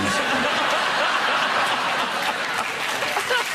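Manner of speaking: high, loud burst of laughter; laughing hard at his own Bond gag; big laugh. Real, not acted. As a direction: crack up at your own joke.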